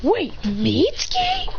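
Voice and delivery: high, drawn-out question; acted surprise. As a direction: stretch it out in high disbelief. Surprised recognition of old friend.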